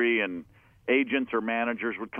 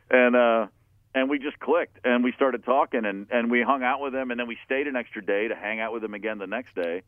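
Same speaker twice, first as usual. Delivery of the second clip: normal talking voice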